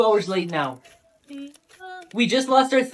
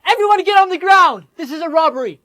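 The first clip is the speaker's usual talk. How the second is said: much higher, louder barked command; robber shout; 8 semitones higher, 7 dB louder than usual. Acted. Robber to the crowd, loud threatening shout. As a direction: yell to take over the room.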